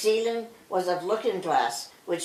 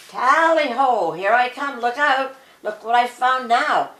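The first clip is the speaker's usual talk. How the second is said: calling out; 4 semitones higher, 5 dB louder than usual. A narrator's reading. Claude calling out again.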